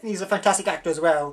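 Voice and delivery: normal talking voice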